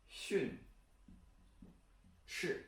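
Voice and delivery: "训", "斥" softly, each falling; fourth-tone demo. Shown as a demonstration. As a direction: isolate "训" and "斥", short falling tones. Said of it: "And finally".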